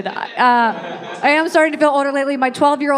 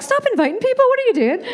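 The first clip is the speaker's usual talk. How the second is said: exasperated mom; 11 semitones higher, faster than usual. Retold or imitated. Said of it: "my mom was like".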